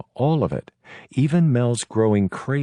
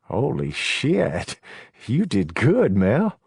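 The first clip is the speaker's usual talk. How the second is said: impressed; 6 semitones higher, much slower than usual. A narrator's reading. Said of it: "eyes grow as round as a kid's".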